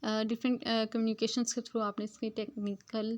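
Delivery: usual speaking voice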